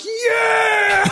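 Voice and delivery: high, drawn-out celebratory yell; retold cheer. Player retelling everyone popping back up, jubilant mock shout. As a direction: re-enact the whole team cheering up high.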